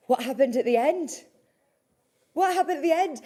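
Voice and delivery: baffled at a cut-off message; puzzled. Real, not acted. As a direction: ask in real confusion. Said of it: "repeats question, puzzled".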